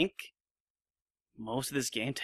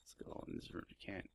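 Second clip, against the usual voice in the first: whispering; 6 semitones lower, 20 dB quieter, faster than usual. Real, not acted. Hushed in game.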